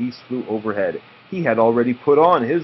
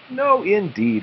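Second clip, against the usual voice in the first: higher, light bird voice; cardinals replying; 10 semitones higher, slower than usual. Acted. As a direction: answer brightly up high. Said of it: "replied the cardinals".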